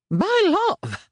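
high, loud, bursting cry; delighted exclamation. A narrator's reading. Lazarus crying out to his son, high and delighted. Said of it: "s'écria Lazarus avec un grand sourire perplexe".

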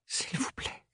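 supplier dans un souffle; chuchotement implorant. A narrator's reading. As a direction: murmure presque suppliant. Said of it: "s'était presque fait implorante". (Ophélie implore Thorne, en chuchotant)

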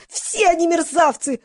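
high, drawn-out squeal. A narrator's reading. Audience member condemning the men, squealing. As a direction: squeal it as an indignant heckler. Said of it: "взвизгнули в зале".

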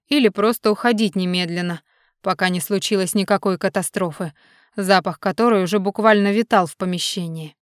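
ordinary delivery

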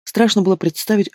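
ordinary delivery, nothing marked

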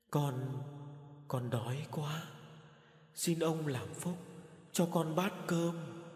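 put-on a ghost child's slow haunting beg. A narrator's reading. Ghost child begs the master again, slow and hollow. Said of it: "bằng giọng sâu thẳm hơn".

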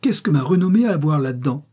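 high, loud, incredulous question; astonished. A narrator's reading. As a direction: demand an explanation in astonishment, rising at the end. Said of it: "si surprise qu'elle en oublia sa colère". (question stupéfaite, voix haute et forte)

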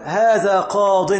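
"قاض" said very slowly ending in a short kasra on the ض, no damma; with kasra not damma. Shown as a demonstration. Teacher showing students the correct ending, slow demo. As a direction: stretch out "هذا قاض" with the ض in kasra. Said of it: "بالكسر لا بالرفع".

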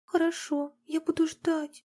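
put-on a small girl bravely agreeing; girl promises her mother she will wait; sad little girl.

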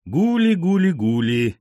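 high, stretched sing-song call; coaxing bird call. A narrator's reading. Narrator calls the caged pigeon, lilting and coaxing.

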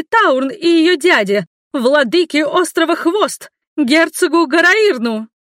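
high, loud outraged tirade; shrill outraged rant. A narrator's reading. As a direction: rant at them, high and loud, slowing at the end. Servant keeps shrieking at guards.

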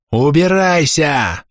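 high, drawn-out hostile yell; hateful shout. A narrator's reading. Villager drives the stranger away, hateful shout. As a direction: yell it long and high, full of hate. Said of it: "полный ненависти окрик".

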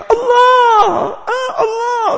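high, loud tearful cry of Allah. Retold or imitated. Preacher imitates the crowd weeping aloud, tearful cry. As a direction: wail the name in tears. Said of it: "teriak cuma".

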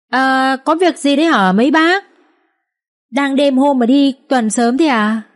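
high, loud wife calling to the guards; said loudly. A narrator's reading. Wife loudly answering the night watch at the gate, high loud call. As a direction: raise the voice so the watch hears. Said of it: "nói rõ to".

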